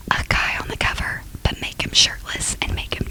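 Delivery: unvoiced conspiratorial whisper. Publisher whispering a cover scheme to colleagues, hushed. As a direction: lean in and whisper the plan.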